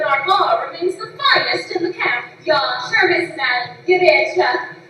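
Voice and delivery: put-on light Norwegian-accented English. Barmaid greeting gentlemen in a Norwegian accent. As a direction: barmaid chatting in a Scandinavian Norwegian lilt. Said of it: "that's quite the accent you got there".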